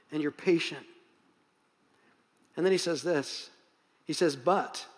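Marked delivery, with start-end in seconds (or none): 4.3-4.8 BUT said low and heavy; emphatic but. Shown as a demonstration. Pastor to church, stressing the big but. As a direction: hit "But" as a big turn. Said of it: "this is a big but".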